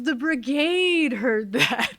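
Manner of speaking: slow, drawn-out reveal; deadpan officer. Retold or imitated. XO telling her the whole brigade heard, slow drawn reveal. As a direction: stretch every word for effect. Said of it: "He goes".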